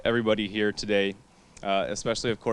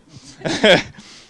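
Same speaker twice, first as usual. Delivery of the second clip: loud laugh; 10 semitones higher, 11 dB louder than usual. Real, not acted. Laughs about late-night speech.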